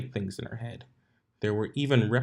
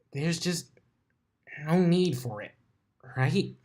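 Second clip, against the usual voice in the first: higher, doubtful Twilight voice; unsure self-talk; 7 semitones higher than usual. A narrator's reading. Twilight asking herself if the spell is needed. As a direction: seek your own reassurance.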